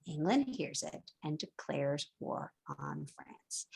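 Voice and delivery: slow, breathy dramatic whisper of the whole line; dramatic whispered repeat for the class. Shown as a demonstration. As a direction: whisper it low, like a secret.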